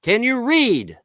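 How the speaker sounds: high, loud challenge; angry taunt. Real, not acted. Ranter challenging trolls and criminals, angry taunt. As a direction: fling the question out loud and high. Demanding whether trolls can read.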